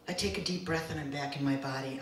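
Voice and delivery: usual speaking voice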